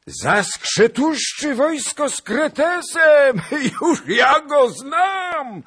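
very high, slow, laughing bellow; shouting. A narrator's reading. Zagłoba teasing Skrzetuski at the feast, laughing shout. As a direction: crow it out with a cackle. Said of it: "krzyknął Zagloba".

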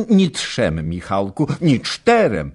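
cheeky bragging; playful boast. A narrator's reading. Young wife boasting to her husband, playful and high.

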